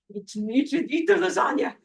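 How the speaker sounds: hammed-up wheedling; tempting vampires. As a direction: coax her like a greedy vampire. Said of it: "they're like".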